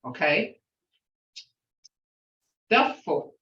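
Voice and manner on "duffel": Duffel said slowly with a falling tune; Muskogee word. Shown as a demonstration. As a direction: give Duffel its Muskogee sound, falling at the end. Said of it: "is a grasshopper to me".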